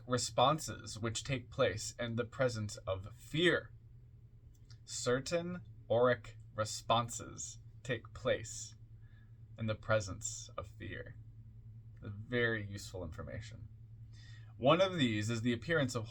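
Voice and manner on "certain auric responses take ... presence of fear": spaced out phrase by phrase, softening at the end; slow, deliberate repeat. Shown as a demonstration. Reader repeats a key point for listeners, slow and deliberate. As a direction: repeat it slowly so each piece sinks in. Repeats the line slowly for emphasis.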